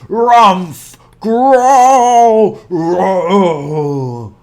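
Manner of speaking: loud rumbling bear noises; loud hammed-up growling bear; bear growls and roars. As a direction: grumble and roar like a bear. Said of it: "Old Bear muttered and growled".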